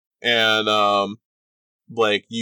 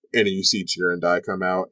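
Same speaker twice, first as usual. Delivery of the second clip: ordinary talk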